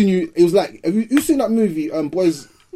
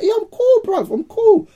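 much higher, lightly mocking I'm cool; mock dazed reply. Friend mimicking the dazed victim, high mocking voice. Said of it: "You're like".

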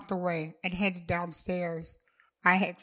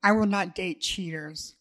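plain reading voice

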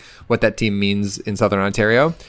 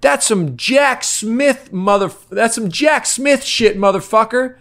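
slow, high jeering heckle; imitating the heckler; 11 semitones higher, slower than usual. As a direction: stretch it out like a drunk fan. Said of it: "And then he goes".

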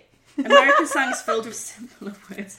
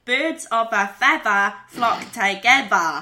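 drawn-out Boston, final R dropped; Boston accent; 3 semitones lower, 4 dB louder, slower than usual. Shown as a demonstration. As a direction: demonstrate Boston speech slowly, open endings. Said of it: "would be".

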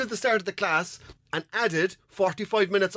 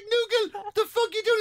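raised-pitch disbelieving call; incredulous call. Retold or imitated. Finding the coworker mid-class, incredulous call. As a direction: call him out in mock disbelief. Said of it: "it's like".

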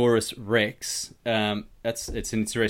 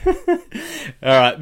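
laughing; 5 dB louder than usual. Real, not acted. Laughing after swearing joke.

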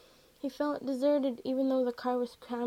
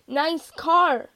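loud, higher taunting call; raised-voice jeer from a passing driver; 5 semitones higher, 10 dB louder than usual. A narrator's reading. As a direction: call it across to another car. Driver calls from another car.

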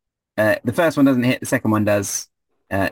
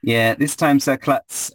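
ordinary talk

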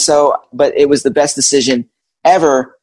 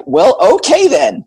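pleasantly taken aback; 10 semitones higher than usual. Retold or imitated. Retold reaction to surprise kiss.

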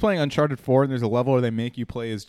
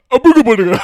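mask guy voice; 11 semitones higher, 13 dB louder than usual. Retold or imitated. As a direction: shout it like a cartoon mask spirit. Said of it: "doing the mask guy voice".

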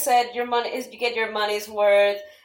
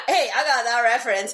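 Captain America quote. Retold or imitated. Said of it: "Like, you know, Captain America".

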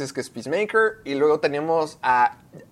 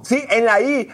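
ordinary talk

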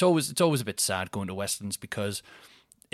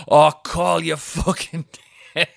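mock angry lads; 6 semitones higher, 9 dB louder, slower than usual. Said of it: "five or six lads going".

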